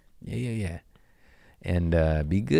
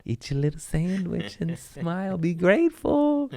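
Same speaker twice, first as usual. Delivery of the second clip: very high put-on voice, rising higher; mock cheery advice; 11 semitones higher than usual. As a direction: squeal it out as mock encouragement.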